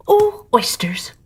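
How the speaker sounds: slow, breathy whisper to the oysters. Acted. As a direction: hush it like a secret. Carpenter addresses the eaten oysters.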